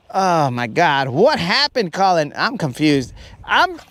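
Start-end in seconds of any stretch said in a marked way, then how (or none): none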